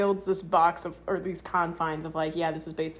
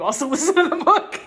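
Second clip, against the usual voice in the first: laughing; 11 semitones higher, 10 dB louder than usual. Real, not acted. Joking request to co-host.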